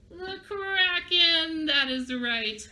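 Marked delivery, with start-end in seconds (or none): 0.1-1.7 high, slow monster announcement; dramatic reveal. Shown as a demonstration. Teacher revealing the scary sea monster to children, dramatic. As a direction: stretch the monster's name out dramatically.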